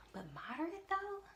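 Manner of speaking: retold squeak of disbelief; incredulous questioning. Wife questions diagnosis to husband in the car, incredulous.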